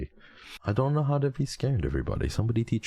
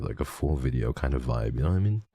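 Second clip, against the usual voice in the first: ordinary talk, nothing marked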